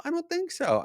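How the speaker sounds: mock innocent reply, way up high; comically high inner voice; high-pitched self-answer. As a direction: answer yourself in a squeaky voice.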